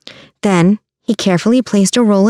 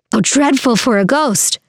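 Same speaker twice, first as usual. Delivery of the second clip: man in crowd calling; 6 semitones higher than usual. A narrator's reading. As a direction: call out a lightly voiced onlooker's dismay. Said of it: "a man's voice yelled out".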